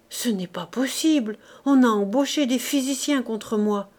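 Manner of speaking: plain reading voice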